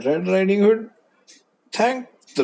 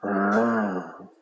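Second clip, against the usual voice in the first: low rolling growl; wolf growl; 7 semitones lower than usual. Acted. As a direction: growl as the wolf posing as grandma. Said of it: "grandma said".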